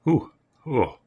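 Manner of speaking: high, impressed gasp at a smell; surprised ooh. Real, not acted. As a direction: react to a strong scent. Strong scent on first smell.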